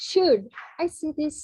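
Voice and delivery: "Should" punched high and loud, then falling; high, loud "Should" asking permission; stress on SHOULD. Shown as a demonstration. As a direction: stress "Should", set apart from the rest. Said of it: "I'm just stressing".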